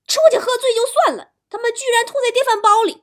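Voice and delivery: furious, high-pitched fast rant; angry dad exploding in a tirade; father scolding. Acted. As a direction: yell at your drunken son, loud and high. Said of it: "听到我老爸在那儿训我哥".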